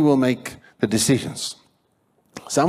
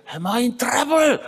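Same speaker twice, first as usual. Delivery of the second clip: high-pitched, strained imitated scream; 13 semitones higher than usual. Preacher imitates the man on the street, screaming. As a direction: shriek the question like the startled man. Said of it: "screaming it out, just like".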